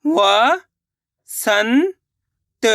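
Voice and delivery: व, सन, त said as separate syllables with pauses between. Shown as a demonstration. Teacher splitting Vasant into syllables for pupils. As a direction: break Vasant into व, सन, त with a pause after each. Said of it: "इस तरीके से एक बार फिर से देखिए".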